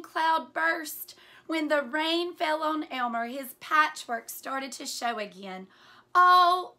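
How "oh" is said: high, loud gasping oh; surprised gasp. Acted. Old elephant gasping at seeing Elmer, surprised. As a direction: gasp out in shock. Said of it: "gasped an old".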